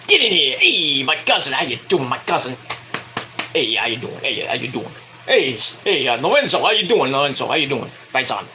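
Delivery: a hint of a friendly Italian-American uncle; Italian-American accent. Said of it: "good old-fashioned Italian people".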